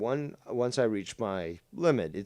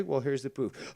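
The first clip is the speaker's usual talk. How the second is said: usual speaking voice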